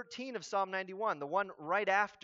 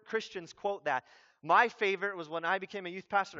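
ordinary talk, nothing marked

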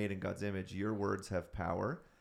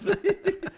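loud laugh; 10 dB louder than usual. Real, not acted. Laughing at the story.

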